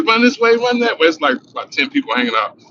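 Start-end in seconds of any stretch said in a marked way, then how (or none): 0.0-1.1 high shouted commands, run this way; police yelling orders. Retold or imitated. Cop yelling at the crowd to run, high shout. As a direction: bark out the orders like a cop on foot.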